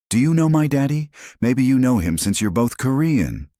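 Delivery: put-on high, eager child voice; bright little girl asking hopefully. Excited child asking her father.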